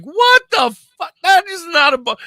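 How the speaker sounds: high, loud startled cry; shocked outburst. Retold or imitated. Hearing a weird noise at camp, high startled shout. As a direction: react to a strange animal noise. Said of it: "I'm like".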